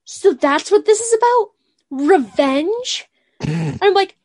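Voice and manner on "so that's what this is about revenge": high, loud mock realization; imitated Marnie. Imitating Marnie stating the obvious to the villain, mock incredulous. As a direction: pitch it up, mocking the obvious question. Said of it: "she goes".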